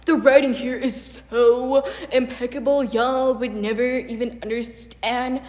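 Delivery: mocking gushing fan. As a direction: ham up a fawning fan. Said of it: "They're like".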